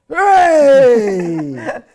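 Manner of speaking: long, high, loud "Hooray!"; crowd cheer. Retold or imitated. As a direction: cheer her on as the whole stadium does. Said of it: "people going".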